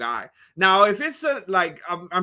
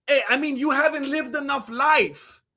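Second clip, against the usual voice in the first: normal talking voice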